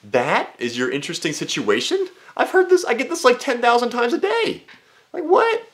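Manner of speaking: answering a guy's so-called interesting situation; incredulous. Retold or imitated. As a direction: squeal in mock disbelief. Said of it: "I'm just like".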